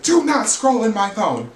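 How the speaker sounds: high-pitched, emphatic scolding in a retold row; mock outraged command. Retold row over snatched phone.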